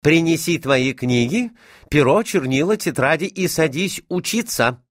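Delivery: commanding mother. A narrator's reading. Mother sends her son to lessons, commanding. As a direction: order a child to study. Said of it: "командовала мать".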